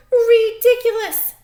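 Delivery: shrill shout of scorn, high and loud; loud scornful duck. Acted. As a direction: scoff it like a mocking duck. Duck scoffs loudly.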